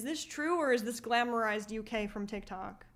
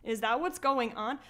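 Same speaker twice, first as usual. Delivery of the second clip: usual speaking voice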